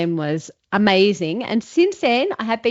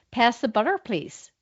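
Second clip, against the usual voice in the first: attempted American with R on "butter"; American accent, R sounded; 3 dB quieter than usual. Shown as a demonstration. Student applying the coach's note, attempted American accent. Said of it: "get your R on butter".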